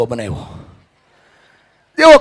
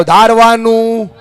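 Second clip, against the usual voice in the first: higher-pitched, drawn-out rallying call; loud call-out; 5 semitones higher, 6 dB louder, much slower than usual. Shown as a demonstration. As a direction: lead the crowd in a slow, loud chant. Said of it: "જોરથી બોલો". (જોરથી, લંબાવીને બોલાવેલો નારો)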